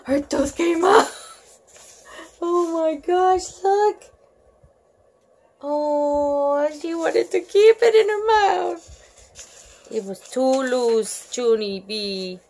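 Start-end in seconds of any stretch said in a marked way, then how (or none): none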